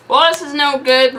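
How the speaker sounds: loud slow complaint; shouted. Acted. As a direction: shout it flailing. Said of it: "Bruce shouted".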